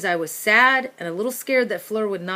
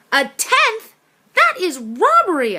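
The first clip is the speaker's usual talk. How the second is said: indignant disbelief; 10 semitones higher, 7 dB louder than usual. Acted. Byrne objects to the rat tax.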